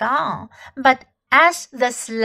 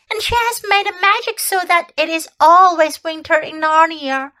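put-on higher, louder eager child's telling; excited little girl; 7 semitones higher, 4 dB louder than usual. A narrator's reading. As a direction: spill the whole tale breathlessly. Lucy voiced, excited girl.

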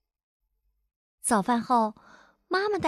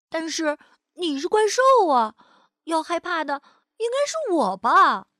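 put-on higher, protesting child voice; incredulous child; 4 semitones higher than usual. Louise argues with the frightened monster, incredulous. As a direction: point out the absurdity, puzzled.

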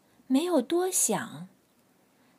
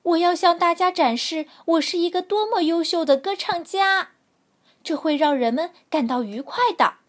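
excited; 7 semitones higher, 7 dB louder, faster than usual. A narrator's reading. Dog boasts eagerly.

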